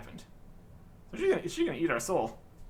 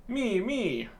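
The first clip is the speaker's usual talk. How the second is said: squeaky kitten; 5 semitones higher than usual. Acted. Kitten answering ferociously.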